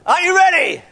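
shouted rally question, high and loud; big hype shout; loud rally call. Shown as a demonstration. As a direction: call it out to the whole room. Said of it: "you have to say".

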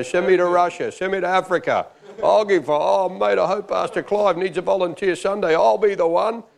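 high, over-eager pleading; mock zealous volunteering. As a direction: imitate a fired-up believer begging to be picked. Acted eager volunteering in prayer.

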